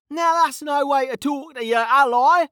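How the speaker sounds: very high-pitched indignant holler; hollered retort. A narrator's reading. Mage answering the officer's threat across the fight, hollered. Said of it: "Kettle hollered back".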